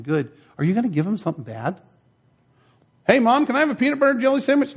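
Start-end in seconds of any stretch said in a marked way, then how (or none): 3.1-4.8 put-on much higher, fast eager kid voice; child asking mom. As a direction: pipe up like a little kid begging mom. Child asking parent for sandwich.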